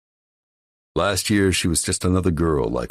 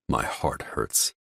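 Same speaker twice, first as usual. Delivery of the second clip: ordinary delivery, nothing marked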